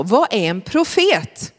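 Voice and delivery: usual speaking voice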